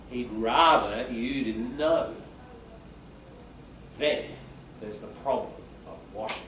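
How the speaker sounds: put-on milk every word like reading to a child; exaggerated storytelling stress. Shown as a demonstration. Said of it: "see how I'm exaggerating".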